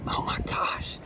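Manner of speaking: whispered eye-roll sigh, acted; mock weary disbelief, barely voiced; exasperated. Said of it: "having an eye rolling moment".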